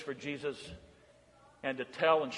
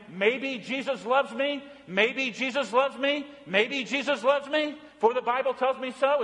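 mock doubtful refrain; 8 semitones higher than usual. Shown as a demonstration. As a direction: sing it as a wrong, unsure version. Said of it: "Is the refrain".

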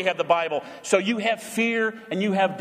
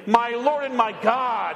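said together with congregation; 3 semitones higher than usual. Real, not acted. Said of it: "say it with me".

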